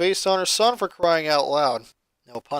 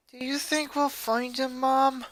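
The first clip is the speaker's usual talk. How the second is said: put-on soft worried girl; 7 semitones higher, 5 dB quieter than usual. A narrator's reading. Worried daughter asks softly.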